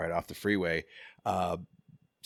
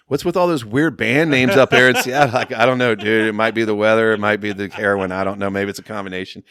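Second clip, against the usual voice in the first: mocking; 10 dB louder than usual. Friends mocking band names.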